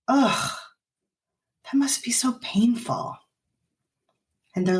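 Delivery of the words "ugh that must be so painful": hushed, gentle sympathy; empathetic whisper. Shown as a demonstration. Parent comforting a hurt child, hushed and gentle. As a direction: soften right down to comfort.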